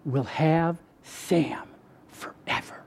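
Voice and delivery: low, very slow, hushed promise; God. Retold or imitated. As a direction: fade almost to a whisper. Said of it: "And then he said".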